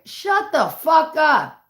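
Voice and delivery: high, loud, drawn-out mock outburst; imitated irritated dad. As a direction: play dad snapping back, slow and loud. Dad's irritated retort, retold.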